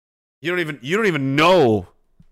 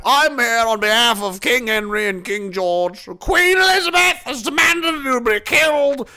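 mock British accent; 8 semitones higher than usual. Mock British royal envoy.

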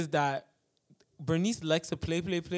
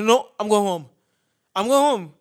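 louder, insistent retold protest; raised, emphatic quoted outburst; mock firm refusal; 3 semitones higher, 7 dB louder than usual. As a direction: imitate a stubborn refusal. Retold refusal during a quarrel.

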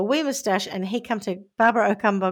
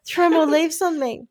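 higher, louder mock passion cries; mock ecstatic; 6 semitones higher, 7 dB louder, slower than usual. Victim gushing at her scammer, hammed-up ecstatic cries. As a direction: ham up breathless delight.

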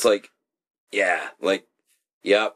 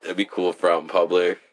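ordinary talk

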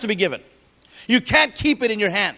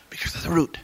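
whispered answer; 5 semitones lower, 7 dB quieter, faster than usual. Real, not acted. Preacher whispers the answer to his own question. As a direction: whisper it, unvoiced and soft.

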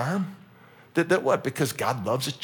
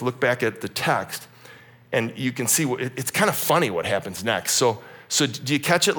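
normal talking voice

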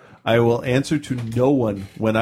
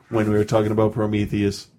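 usual speaking voice

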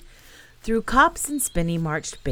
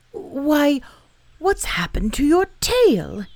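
higher, louder surprised bear voice; a little bear suddenly startled; 9 semitones higher, 6 dB louder, slower than usual. Acted. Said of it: "he said in surprise".

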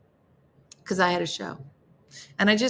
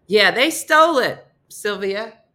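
mock rep; 4 semitones higher, 7 dB louder than usual. Mock rep admitting the theft.

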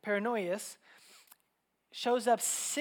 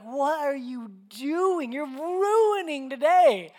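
loud, high, drawn-out exasperation; boiling over; 6 semitones higher, 9 dB louder, much slower than usual. Retold or imitated. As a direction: stretch each word in mock outrage. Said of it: "boiling over".

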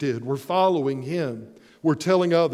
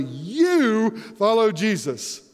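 "You" stressed, slow and deliberate; emphatic You; 3 semitones higher, slower than usual. Shown as a demonstration. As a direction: press "You follow Jesus" slowly. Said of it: "emphasizing that very loudly".

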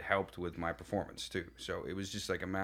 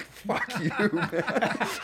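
high mock curse through laughter; laughing, exasperated comeback; laughing retort; 11 semitones higher, 5 dB louder, much slower than usual. Sweating actor's retort to castmate, retold.

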